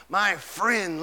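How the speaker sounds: ordinary delivery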